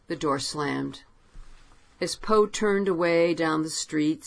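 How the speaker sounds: ordinary delivery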